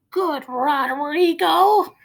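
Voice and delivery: very high, slow, sweet; old lady cooing. Acted. Old lady praising the moose, high and cooing. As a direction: coo it like a doting grandma. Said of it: "old lady praising".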